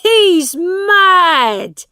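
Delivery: high, very loud outburst; shouting. A narrator's reading. Father declaring his son crazy, shouting. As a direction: yell it out in scornful disbelief. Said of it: "shouted John Canty".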